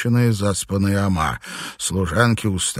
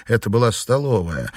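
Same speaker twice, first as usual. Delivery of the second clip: ordinary talk, nothing marked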